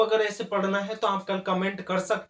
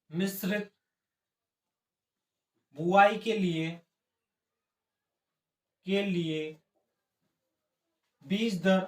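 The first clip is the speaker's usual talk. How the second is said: word by word with long pauses; dictation; slower than usual. Real, not acted. Teacher dictating notes for students to write down, broken-up delivery. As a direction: dictate in small chunks, leaving time to write.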